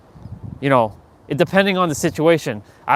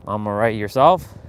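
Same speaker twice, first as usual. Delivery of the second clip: bored small talk; 6 semitones lower than usual. Acted. Andre answering a boring greeting, low and uninterested. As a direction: answer with no interest at all. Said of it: "they're boring, and they're monotonous".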